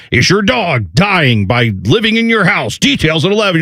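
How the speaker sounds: mock ominous TV newsreader tease; news teaser. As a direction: sell it like a scare-story promo. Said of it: "all the news channels".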